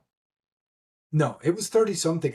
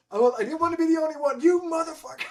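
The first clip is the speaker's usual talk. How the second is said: playfully cuss out your friend; fast high mock outrage; 13 semitones higher, faster than usual. Friend jumps in after him.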